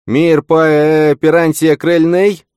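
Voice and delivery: high, loud, drawn-out call; shouted foreign phrase, stretched out high; shouting. A narrator's reading. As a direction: holler it slowly across a distance. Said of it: "закричал снова".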